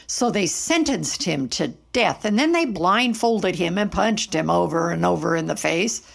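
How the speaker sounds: ordinary delivery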